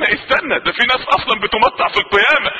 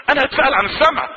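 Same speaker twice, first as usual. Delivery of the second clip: ordinary talk, nothing marked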